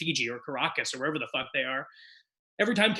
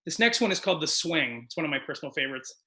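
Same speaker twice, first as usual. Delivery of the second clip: normal talking voice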